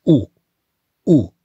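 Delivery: slow clear teacher's "ou", rounded lips; model sound ou. Shown as a demonstration. Teacher models "ou" for pupils, slow and clear. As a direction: say "ou" slowly and clearly for learners. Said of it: "qui se prononce".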